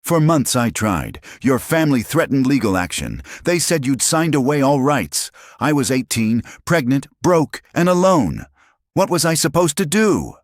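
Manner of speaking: high, loud, desperate pleading; anguished outburst. A narrator's reading. Mother rages at Minho over lost years.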